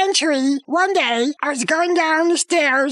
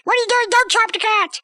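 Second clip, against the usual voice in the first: high-pitched mock alarm; alarmed wife; 6 semitones higher than usual. Storyteller as wife grabbing his arm, mock alarmed. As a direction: imitate a wife crying out to stop him. Wife stopping the axe, retold.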